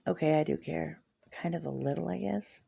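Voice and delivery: softly admitting she does care; sheepish admission. Real, not acted. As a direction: back down quietly, a little embarrassed. Sheepish admission after defiance.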